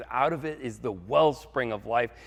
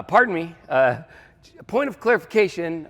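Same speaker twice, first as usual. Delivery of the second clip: high, louder, halting interjection; polite objection; 5 semitones higher, 6 dB louder than usual. Acted. Gideon asking the angel for clarification, pitched up and hesitant. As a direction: butt in politely but insistently.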